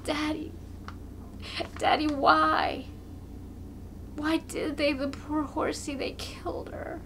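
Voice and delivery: put-on high, breaking whisper of a child; sobbing child. A narrator's reading. A boy asking his father why they killed the horse, sobbing. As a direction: sob the question through catching breath. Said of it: "He sobs, his breath catches".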